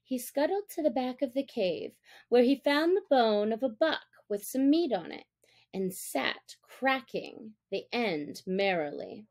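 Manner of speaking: plain reading voice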